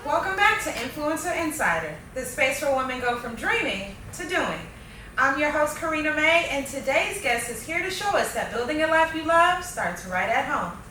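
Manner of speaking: upbeat, polished show opener; podcast host intro. Acted. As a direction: read it like a show host welcoming listeners.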